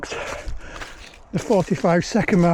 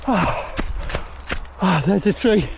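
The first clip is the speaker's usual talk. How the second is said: cycling hard; slower than usual. Real, not acted. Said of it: "my legs are burning now".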